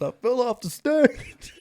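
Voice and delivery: high, loud, slow retold cry; hammed-up wail. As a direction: wail it through rising laughter. Retelling friend's mishap to laughs.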